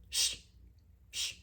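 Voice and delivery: two separate shushes; unvoiced-breath "shh" to silence someone; hushing. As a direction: shush her, one long breath at a time. Hushing Garcelle in the retelling.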